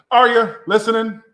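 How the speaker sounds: high, loud call to the viewers. Real, not acted. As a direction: demand their attention, loud and high. Calling out to ignoring viewers.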